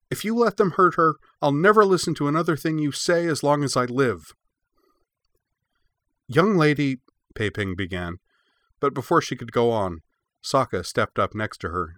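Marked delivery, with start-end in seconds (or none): 0.1-4.3 put-on high, loud child's ultimatum; glaring threat. A narrator's reading. Cora shields the puppy from both masters, glaring and threatening. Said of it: "she glared at Kanzo and Pei Ping". 6.3-7.0 loud, high reprimand; scolding. A narrator's reading. Pei Ping begins to scold Cora, sharply reproving.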